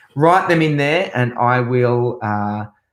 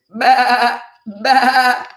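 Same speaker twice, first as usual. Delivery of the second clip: high, louder baa; high bleating sheep for kids; 10 semitones higher, 4 dB louder than usual. Pretend. Sheep sound in children's song.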